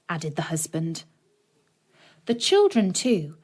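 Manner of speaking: usual speaking voice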